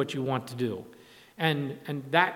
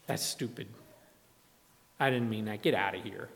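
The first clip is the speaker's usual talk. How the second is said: lower, softer acted brush-off; mock dismissive; 6 semitones lower, 5 dB quieter than usual. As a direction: lightly play someone waving off a rebuke. Acting the offender brushing off rebuke.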